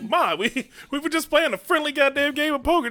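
high-pitched whiny protest, lightly retold; protesting son. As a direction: plead with mom that it was just a friendly game. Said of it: "I'm like".

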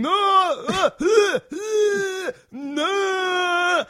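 high, loud pained wail; old man dropping the prayer books, crying out high and loud; anguished cry. Retold or imitated. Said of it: "'cause he's going".

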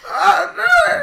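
sudden high cry of pain; pained yelp. Shown as a demonstration. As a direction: yelp out loud as if in agony. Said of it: "if throughout the episode you hear any sort of".

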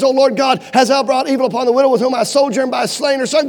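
loud, shouted prayer; crying out in prayer. Retold or imitated. Elijah crying out to God over the dead boy, shouting. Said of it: "the Bible says he prays".

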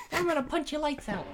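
squeaky, lisping mock threat; comically high-pitched tough talk; Mike Tyson. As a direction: put on a very high boxer's voice. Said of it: "Mike Tyson".

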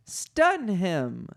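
high, exasperated mock shout; shouted demand. A narrator's reading. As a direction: demand answers, then give the order. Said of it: "shouted Leia".

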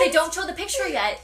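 high, loud and eager; animated, pushing on through the scary bit; excited horror retelling; animated storytelling. Real, not acted. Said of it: "stop being so animated".

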